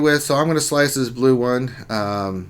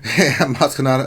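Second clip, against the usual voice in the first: ordinary talk, nothing marked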